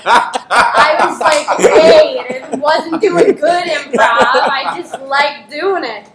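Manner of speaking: loud, high laughing talk. Real, not acted. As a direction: talk while cracking up. Laughing through story.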